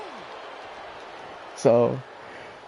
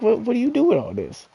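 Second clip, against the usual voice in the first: much higher, incredulous outburst; pitched-up what-the-heck question; exasperated disbelief; 11 semitones higher than usual. Retold or imitated. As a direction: throw up your hands in disbelief. Exasperated at girlfriend's answer.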